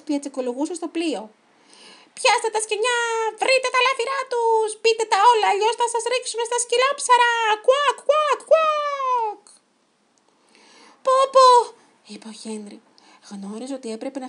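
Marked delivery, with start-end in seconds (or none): 2.2-9.4 very high, loud cartoon chicken voice; squawking chicken pirate. A narrator's reading. Chicken pirate ordering the gang to loot the ship, squawking. 11.0-11.5 high, loud cry of dismay; alarmed exclamation. A narrator's reading. Henry seeing pirates raid the ship, alarmed exclamation.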